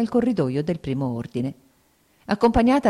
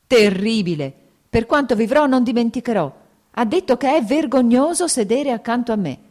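higher and louder, pouring out her hurt; anguished outburst; 7 semitones higher, 5 dB louder than usual. A narrator's reading. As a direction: keep shouting in wounded despair. Anna's outburst continues to lover. (sfogo disperato, più alto e forte)

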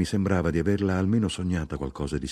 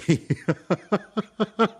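high, staccato peal of laughter; performed high giggle; acted laugh; 10 semitones higher than usual. A narrator's reading. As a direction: laugh out in separate light bursts. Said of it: "Io risi".

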